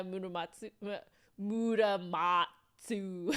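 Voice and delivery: put-on Japanese name in a drawn-out American accent. Streamer mimicking Americans saying a name, American accent. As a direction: stretch the syllables like an American reading Japanese. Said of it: "like Americans saying".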